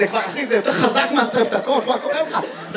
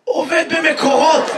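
slow, strained hoarse shout; drawn-out croaky yell; 3 semitones higher, 4 dB louder, slower than usual. Retold or imitated. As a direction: force the answer through a hoarse throat. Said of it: "ממה אתה צרוד".

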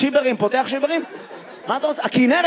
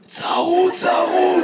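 hoarse voice, pitched up; 5 semitones higher, much slower than usual. Retold or imitated. Audience member explains why he sounds like that, hoarse. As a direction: croak it out higher, as if voice is gone. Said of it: "ממה אתה צרוד".

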